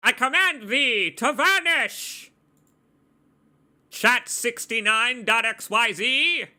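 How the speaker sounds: high, slow, theatrical command; imitating Crash Bandicoot. Streamer banishing a spam bot, in a hammy high voice. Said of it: "that's probably what Crash Bandicoot sounds like".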